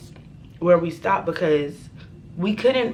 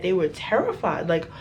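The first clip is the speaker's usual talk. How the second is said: usual speaking voice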